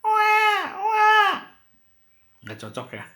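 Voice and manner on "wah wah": high, loud mock baby wail; imitated baby cry. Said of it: "Adik bayi menangis". (menirukan tangisan bayi yang keras)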